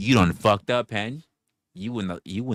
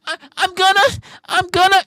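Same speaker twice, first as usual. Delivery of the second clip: high squeaky pleading, much louder; mock begging falsetto; 13 dB louder, faster than usual. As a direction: beg in a hammed-up falsetto. Mocking her pleading.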